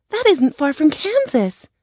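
put-on high-pitched girlish exclamation; high, eager girl; excited Dorothy. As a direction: cry out in a high girl's voice. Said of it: "Dorothy cried".

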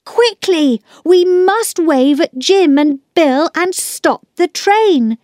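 put-on high-pitched boy rallying the others; pressing storybook call to action; urgent shout. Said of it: "Peter shouted".

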